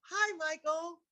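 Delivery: high, bright rehearsed hellos to Michael, tried over and over; practicing a casual greeting in the mirror, pitched high; rehearsing a greeting. Retold or imitated. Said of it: "in a mirror all night going".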